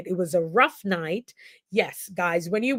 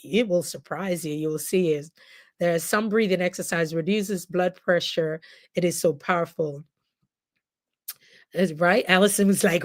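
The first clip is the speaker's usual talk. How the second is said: usual speaking voice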